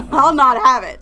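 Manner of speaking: loud, mock-offended parent; hammed-up scolding. Friends joking about scolding a pointing child. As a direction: scold like an outraged parent, tongue in cheek.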